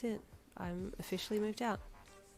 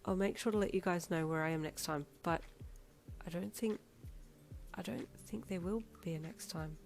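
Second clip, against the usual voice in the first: quiet sad reply; 6 dB quieter than usual. A narrator's reading. Teen apologising, emotional.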